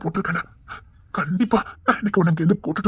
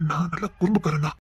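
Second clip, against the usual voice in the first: usual speaking voice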